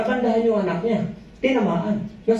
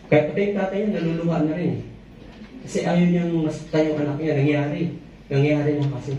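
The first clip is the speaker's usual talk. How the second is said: normal talking voice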